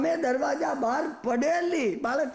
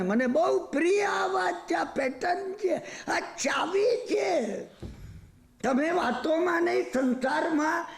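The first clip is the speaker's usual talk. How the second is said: raised, emphatic sermon voice, pressing the point home; forceful preaching; 3 semitones higher than usual. Acted. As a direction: preach it hard to the listeners. Preacher drives home the lesson.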